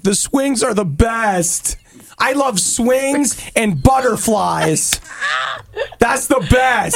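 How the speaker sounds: put-on slow, high, singsong little kid. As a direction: gush like a happy small child. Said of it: "You used to be like".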